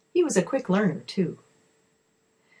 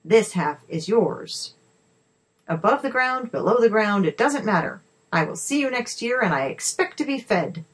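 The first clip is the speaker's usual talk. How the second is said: higher-pitched, cross ultimatum; angry demand; 5 semitones higher, 3 dB louder than usual. A narrator's reading. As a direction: lay down terms in a huff. Frustrated Boggart lays down his terms.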